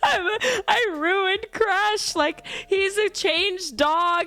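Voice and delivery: high, slow, wailing sob-talk through laughter; retold sobbing. Owner reenacting her sobbing breakdown over her dog, high tearful wail. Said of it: "start sobbing".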